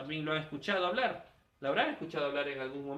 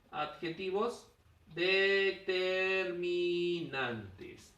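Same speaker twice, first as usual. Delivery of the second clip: very slow, stretched out; teacher stretching the key term; drawn-out term; much slower than usual. Shown as a demonstration. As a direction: spell out the term slowly, syllable by syllable. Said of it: "¿Ya ves? Adjetivos determinantes".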